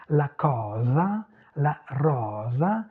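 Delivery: cosa and rosa, slow, with open o; open Italian o. Shown as a demonstration. Teacher contrasting Italian with French, open o in cosa and rosa. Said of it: "c'est ouvert".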